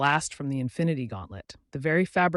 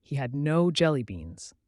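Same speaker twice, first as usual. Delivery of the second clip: usual speaking voice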